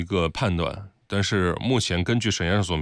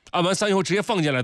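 urgent; 8 semitones higher, faster than usual. Real, not acted. Urging the producer on air.

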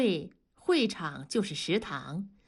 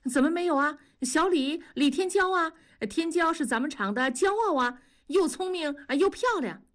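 higher-pitched, lively protest full of praise; eager praise; 7 semitones higher than usual. A narrator's reading. Co-worker singing a young woman's praises to his mate, eager and high. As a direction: talk her up brightly in a higher voice.

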